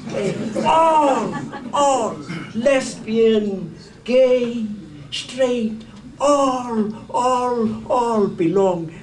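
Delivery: loud, slowed all; fervent preacher's list; insistent all. Real, not acted. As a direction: drive home every all.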